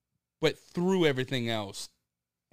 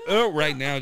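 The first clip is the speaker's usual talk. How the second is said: louder, choking laugh; laughing while gagging; 6 semitones higher, 11 dB louder than usual. Real, not acted. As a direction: cough it out mid-laugh.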